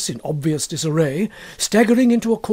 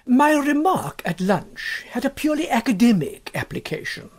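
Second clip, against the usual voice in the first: a lightly voiced Egbert, higher, stiffly formal; hint of a prim husband opening a truce; Egbert announcing; 7 semitones higher than usual. A narrator's reading. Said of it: "he announced".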